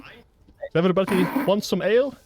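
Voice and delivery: a jolly Scottish dwarf miner; mock Scottish dwarf accent. Shown as a demonstration. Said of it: "give it a Scottish accent".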